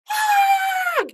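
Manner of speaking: high yelled shriek. Acted. Boy turning green: sudden high shriek. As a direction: shriek in alarm. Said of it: "Tim yelled".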